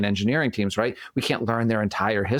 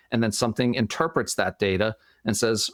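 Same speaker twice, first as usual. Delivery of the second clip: ordinary delivery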